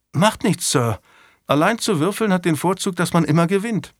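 light, high voice for an obliging lad; young squire, cheerful. A narrator's reading. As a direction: play the good-humoured squire answering his master. Squire Alexander voiced in story.

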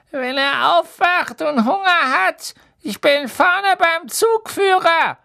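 very high, loud, slow witch voice; stretched-out, high-pitched character voice; trolley witch. A narrator's reading. Trolley witch tells the children where she'll be, shrieky high voice. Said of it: "sagte die Hexe".

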